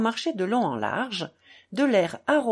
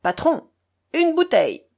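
loud, high order; rousing shout for service; calling out; 7 semitones higher, 6 dB louder than usual. A narrator's reading. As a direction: hail the landlord across a room. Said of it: "cria Mitya".

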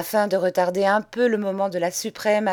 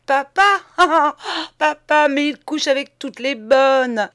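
higher and louder, laughing through the words; bursting with laughter; 6 semitones higher, 6 dB louder than usual. Acted. Young master mocking his father, laughing loudly. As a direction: laugh out loud between the words. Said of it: "Son rire redoubla, éclata tout à fait".

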